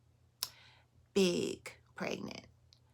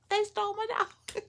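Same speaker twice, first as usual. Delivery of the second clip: much higher, squeaking it out; squeaky disbelief; 14 semitones higher than usual. Real, not acted. Repeating to the audience, a high astonished squeak.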